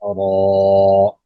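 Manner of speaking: drawn-out recited syllable; tajweed recitation. Shown as a demonstration. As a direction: chant it long. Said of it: "लाम पे हरफी मुसक्कल". (तिलावत के अंदाज़ में)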